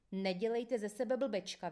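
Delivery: usual speaking voice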